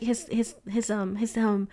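halting, stuck repeats with ums; retold stuttering. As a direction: imitate stumbling over the first word. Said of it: "and I was stuttering".

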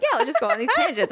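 high, fast carefree chirp; breezy, not paying attention; careless chirpy voice. Retold or imitated. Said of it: "I'm so tired that I'm like".

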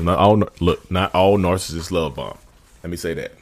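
ordinary talk, nothing marked

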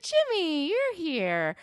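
high, loud mock surprise; imitated surprised greeting. Ashley walking out to find Jimmy, high mock surprise. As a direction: sing out the name, high and startled. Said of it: "And she's like".

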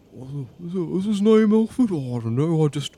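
lightly voiced stranger; a put-on character voice; npc voice.

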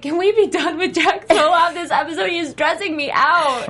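high, laughing mock complaint; laughing plea. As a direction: beg through a laugh, half joking. Exasperated plea about a character.

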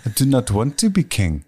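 a lighter Schwarzenegger accent with a Pauly Shore twist; Pauly Shore Schwarzenegger mix. Shown as a demonstration. For the hosts, Uhtred redone as Arnold crossed with Pauly Shore. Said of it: "Pauly Shore is fucking Arnold Schwarzenegger".

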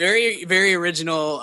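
sarcastic. Acted. Sarcastic agreement.

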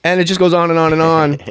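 ordinary delivery

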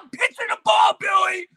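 put-on the kid insists about the Boeing; mock pleading shout. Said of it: "It's like".

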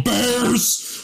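high mocking yell of a drunk guy; drunk heckler yelling. Guy in the theater yelling at the bear scene, high mock yell. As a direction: shout it like an obnoxious drunk in the seats. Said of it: "one of these dudes just going".

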